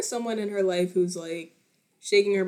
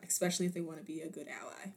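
whispered aside; 5 semitones lower, 13 dB quieter, much faster than usual. Acted. Joking aside after plugging poetry.

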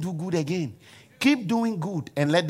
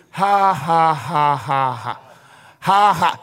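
loud, spaced mock laughter; deliberate forced laugh; 6 dB louder, much slower than usual. Shown as a demonstration. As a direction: pump out a practice laugh. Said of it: "You look in the mirror, you are doing".

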